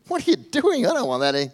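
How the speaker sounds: high, loud squawk of a blind man getting mud on his face; startled blind man. Acted. Blind man protesting as Jesus puts mud in his eyes, high and startled.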